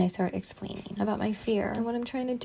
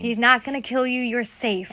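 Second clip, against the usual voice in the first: forceful raised-voice reassurance; loud, authoritative; 3 semitones higher, 10 dB louder than usual. Retold or imitated. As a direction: insist loudly and firmly.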